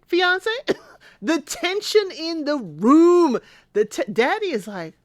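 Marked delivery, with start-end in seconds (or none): 0.1-0.5 surprised dad. Retold or imitated. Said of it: "He's like". 1.2-3.4 dramatic aside. Pretend. Comic aside on awkward moment.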